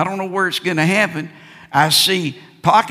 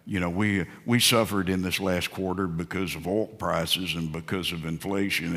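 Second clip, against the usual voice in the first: lower, softer smug expert voice; mock TV pundit; 9 semitones lower, 5 dB quieter than usual. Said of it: "You see 'em on T V".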